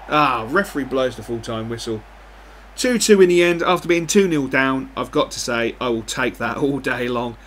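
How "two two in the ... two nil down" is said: louder, higher burst of joy; excited relief. Real, not acted. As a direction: celebrate the late equaliser out loud. Comeback draw at full time.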